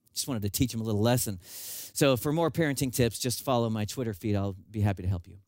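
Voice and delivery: normal talking voice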